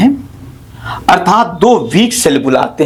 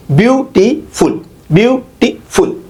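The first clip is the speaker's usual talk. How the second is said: slow, higher "beautiful" broken into three beats; syllable by syllable; 4 semitones higher, slower than usual. Shown as a demonstration. Teacher counting syllables of a dactyl, broken up slowly. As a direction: split "beautiful" as view, टी, फुल with small gaps. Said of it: "तो इसमें दो जो है".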